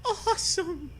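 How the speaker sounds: a high, hammed-up little cry of joy; mock soft cry. Kid on a skateboard cheering his own trick, high and hammy. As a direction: squeal it way up, not actually soft. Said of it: "you cry softly".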